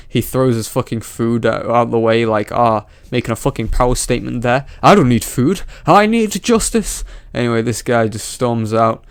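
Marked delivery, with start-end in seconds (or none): 4.8-7.0 high-pitched, hammed-up imitation of a protester; mock righteous outburst. Commentator mocking the man throwing his food away, hammed-up indignation. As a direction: declare it as a mock power statement, rising higher. Mocking the man's power statement.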